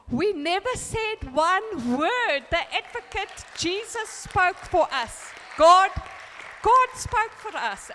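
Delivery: loud, high, drawn-out emphatic declaration; triumphant testimony. Real, not acted. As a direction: proclaim the victory slowly, ringing out high and loud.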